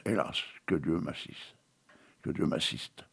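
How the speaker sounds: whispered, grieving plea; hushed lament. Acted. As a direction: breathe it out in sorrow. Poem's grief line, breathy.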